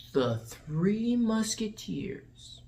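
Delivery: very slow, softened imitation of awe; dramatic title drop. D'Artagnan naming the heroes, slow hammed-up title drop. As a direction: draw out each word portentously. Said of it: "they get a title drop".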